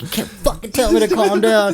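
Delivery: very high-pitched mock scream; retold screaming. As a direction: re-enact hysterical outrage at the other driver. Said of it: "I'm, like, screaming at this poor lady".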